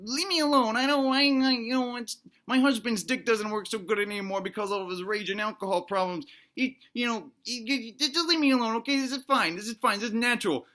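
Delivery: flustered, pleading to be left alone; defensive wife. Acted. Wife defends herself to the intruding granny, defensive. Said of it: "And the wife is like".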